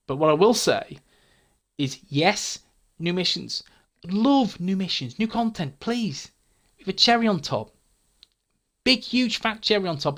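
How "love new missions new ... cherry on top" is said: higher pitched, gushing wish list; eager wishing. Real, not acted. Gushing wish list for new content.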